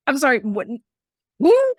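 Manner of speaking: from puzzled to a squeaked high Who; mock disbelief climbing into a high yelp; baffled, voice jumping up on Who; incredulous squeak.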